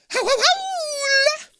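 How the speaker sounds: very high, slow howling; wolf howl. A narrator's reading. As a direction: howl like werewolves. Werewolves howling.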